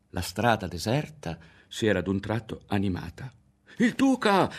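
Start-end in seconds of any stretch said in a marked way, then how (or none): 3.8-4.5 put-on high, loud boyish cry; excited shout. A narrator's reading. Berto cries out as he spots the Duke. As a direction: shout out at the sight. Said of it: "gridò Berto".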